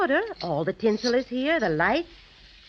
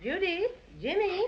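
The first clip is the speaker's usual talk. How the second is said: high call from another room; calling from afar; 9 semitones higher, 4 dB quieter than usual. Acted. Mother calls her children from downstairs, distant high call.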